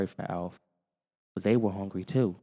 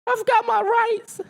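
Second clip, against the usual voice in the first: very high, slow squeaky protest; Alf's alien voice; 8 dB louder, slower than usual. Acted. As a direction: insist on rights in the alien voice. Alf's voice continues.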